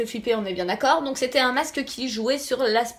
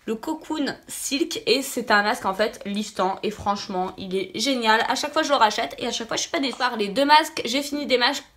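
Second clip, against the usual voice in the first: normal talking voice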